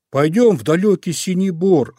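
high, loud, eager suggestion; excited cry. A narrator's reading. Little bear proposes an outing, crying excitedly. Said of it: "закричал Мишка-медвежушка".

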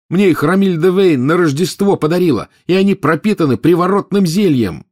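high, loud exasperated lecture; heated explanation. Acted. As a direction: hammer the truth home.

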